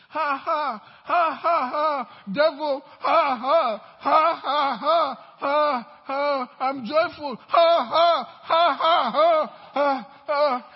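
loud, forced defiant laughter; laughing at the devil. Retold or imitated. Preacher acting out a businessman laughing at the devil, loud forced laughter. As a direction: belt out a hammed-up mocking laugh. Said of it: "you are not laughing at the devil".

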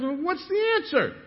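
high, loud, pleading for the answer; squeaky outrage at being beaten; exasperated demand. Retold or imitated. Businessman begging the old man, high exasperated cry.

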